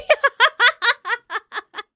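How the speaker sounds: loud, high cackle; bursting laughter. Real, not acted. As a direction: crack up loudly at your own joke. Cracks up after own joke.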